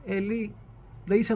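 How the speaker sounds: ordinary talk, nothing marked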